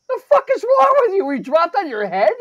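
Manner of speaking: very high, loud indignant outburst; outraged disbelief. Retold or imitated. Shopper berating the stranger in line, outraged. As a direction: yell it in appalled disbelief. Retelling his outraged reply.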